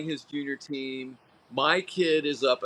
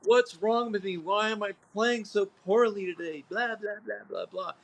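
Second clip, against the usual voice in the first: higher, whiny complaining player; mock whiny player fading into blah blah; mock whining; 6 semitones higher than usual. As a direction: whine it like a sulking player. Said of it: "they whine and complain".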